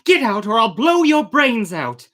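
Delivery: loud, high furious threat; enraged captain threatening. Acted. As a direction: bark the threat at the cloud. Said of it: "said Vanderdecken".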